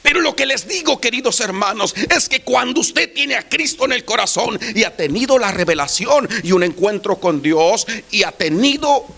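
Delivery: high, loud preaching voice; fervent sermon climax; full-force preaching. Acted. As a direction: proclaim it to the congregation.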